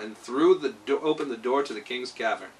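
plain reading voice

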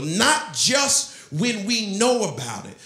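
usual speaking voice

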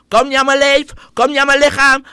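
high, loud mock yell of "lichaam"; mock shout. Mocking the assembly member screaming in the hall, loud and high. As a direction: ham up the politician's indignant screaming. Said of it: "Dan mag je mooi gaan schreeuwen".

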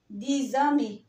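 "دي زامي" with a z joining the words; liaison with z. Shown as a demonstration. Teacher repeating ten friends for beginners, linking z. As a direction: turn the x into z in "دي زامي". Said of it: "تبقى الإكس هنا ز".

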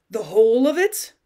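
loud, rising disbelief; incredulous question. A narrator's reading. Cousin asking about the lost dowry, incredulous. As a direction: query it with a sharply lifted end.

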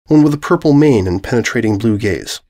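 ordinary delivery, nothing marked

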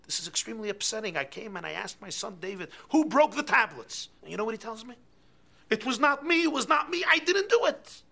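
loud, high indignant complaint; furious parent shouting at the teacher; outraged father. Acted. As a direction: rant at the teacher in disbelief.

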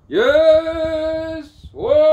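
high, loud whoop; ecstatic cry. Real, not acted. Overjoyed whoop to himself, high and loud. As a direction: let it burst out.

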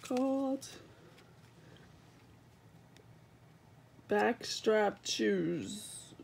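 talking through a yawn; words stretched by a yawn. Real, not acted. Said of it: "I'm sorry I'm yawning".

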